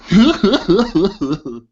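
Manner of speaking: high, loud character cackle; dwarf's cackle. Acted. Third voice below in the well, high cackle. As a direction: cackle in bursts, high and falling. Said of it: "you hear another voice go".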